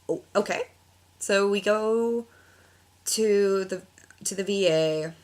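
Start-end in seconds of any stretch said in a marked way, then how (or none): none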